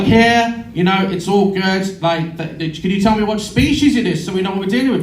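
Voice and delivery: lightly imitated Australian receptionist; attempted Australian accent. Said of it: "I can't do an Australian accent".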